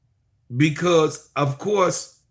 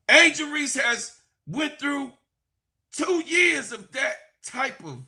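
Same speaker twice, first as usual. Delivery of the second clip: preaching; 9 semitones higher than usual. Real, not acted. Preacher rallies listeners about Angel Reese, preaching high. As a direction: preach it to the congregation.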